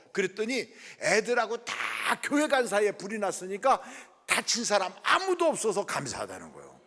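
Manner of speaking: ordinary delivery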